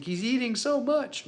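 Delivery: high-pitched, drawn-out imitation of an amazed wife; imitated amazement. Wife reports on the baby's cluster feeding, high imitated amazement.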